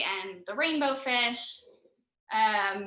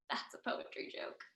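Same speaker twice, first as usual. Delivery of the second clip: soft whispered joke; whispered aside; 14 dB quieter than usual. Real, not acted. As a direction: whisper it to the crowd. Whispered aside during reading.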